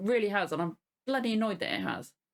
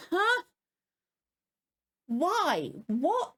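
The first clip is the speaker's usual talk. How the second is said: baffled outburst; 7 semitones higher, much slower than usual. Retold or imitated. Fan voicing her confused head at the episode, high baffled squeaks. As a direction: squeak out each question like you can't believe it.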